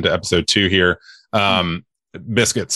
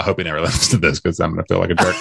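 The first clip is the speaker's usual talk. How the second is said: usual speaking voice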